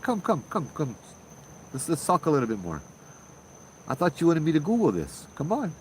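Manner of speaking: high, playful coaxing to come back. Retold or imitated. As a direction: lure him back with a light high voice. Coaxing patient back to talk.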